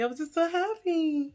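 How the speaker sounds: gushing, overjoyed; giddy. Shown as a demonstration. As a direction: squeal it with delight.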